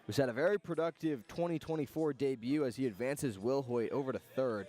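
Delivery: ordinary talk, nothing marked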